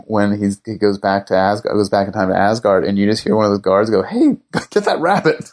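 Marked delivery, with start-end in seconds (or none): 4.0-5.5 high-pitched mock guard call, with a laugh. Retelling a guard spotting the rabbit, amused high call-out. Acted guard calling out in Asgard.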